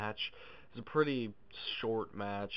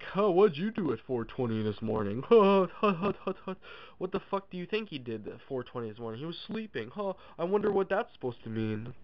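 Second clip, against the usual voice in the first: mocking Taz; 6 semitones higher, 6 dB louder than usual. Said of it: "Taz is like".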